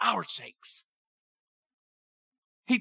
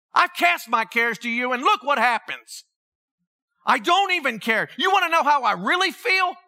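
higher-pitched, heated retold rant; angry attitude; 5 semitones higher than usual. Venting to God about his cares, acted angry defiance. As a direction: challenge God with mounting indignation, short of shouting. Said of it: "That was my attitude".

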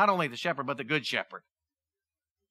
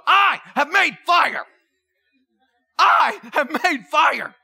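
loud, high, slow triumphant yell; excited shout; 8 semitones higher, 9 dB louder, slower than usual. Retold or imitated. Castaway hero celebrating his first fire, shouting slowly and high. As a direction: bellow it like a castaway who finally made fire. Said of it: "he gets so excited and he goes".

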